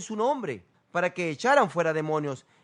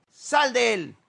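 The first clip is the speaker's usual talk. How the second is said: mock exorcist shout; 5 semitones higher, 6 dB louder, slower than usual. Mimicking exorcists' command.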